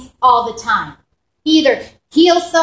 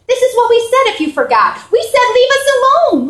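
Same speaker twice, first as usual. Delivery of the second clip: whiny retort; 11 semitones higher, 4 dB louder than usual. Retold or imitated. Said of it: "meant to be rhetorical, but they answered".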